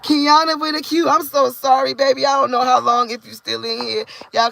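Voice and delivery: high, loud, gushing greeting; excited. Real, not acted. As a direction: squeal the name out with delight. Excitedly greeting a commenter live.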